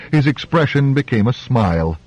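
usual speaking voice

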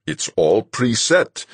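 flat refusal, hard as iron; captain shutting down protest. A narrator's reading. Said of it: "iron hard".

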